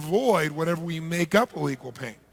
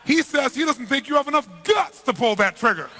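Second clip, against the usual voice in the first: high, loud punchline dare; mock defiant taunt; 8 semitones higher, 6 dB louder than usual. Interpreter falsely daring the boss to shoot, loud and defiant. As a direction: throw down the challenge boldly.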